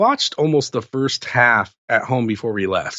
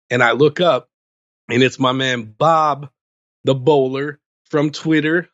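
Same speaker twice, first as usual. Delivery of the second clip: ordinary delivery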